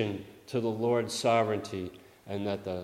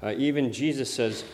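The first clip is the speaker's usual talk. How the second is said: normal talking voice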